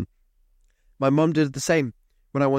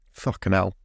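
ordinary delivery

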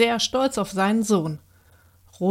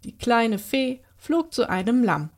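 plain reading voice

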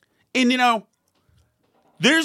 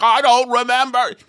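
mocking Trump; 3 semitones higher, 5 dB louder than usual. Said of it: "and he's like".

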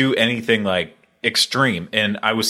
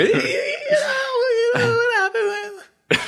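very high, slow squeal through laughter; squeaky high voice; much slower than usual. Retold or imitated. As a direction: pitch it way up, laughing. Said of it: "Oliver's voice went up four octaves".

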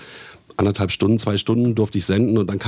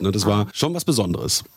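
usual speaking voice

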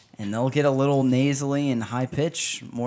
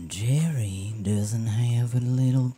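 put-on slow, drawn-out creepy ad narrator with a slight country accent; creepy, slightly country announcer voice; creepy voiceover guy; 2 semitones lower, slower than usual. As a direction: stretch it out like a creepy attack-ad voiceover. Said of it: "creepy voiceover guy has, like, a slight country accent".

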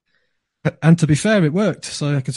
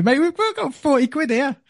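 much higher, bursting with excitement; excited. Retold or imitated. To his mate about the forty quid pot, excited. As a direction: tell him like it's a fortune.

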